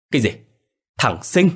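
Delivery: the name hits him like a blow; alarmed. A narrator's reading. Old man hearing his grandson's name, alarmed disbelief. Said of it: "càng hốt hoảng".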